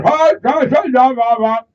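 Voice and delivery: very high, loud mock phone greeting; loud phone talker. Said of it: "he's talking like that".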